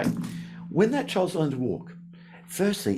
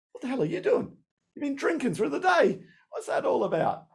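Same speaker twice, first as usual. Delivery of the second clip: high, disbelieving telling-off; incredulous scolding; 12 semitones higher than usual. Pretend. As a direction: scold a drunk friend in disbelief. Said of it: "you'd say".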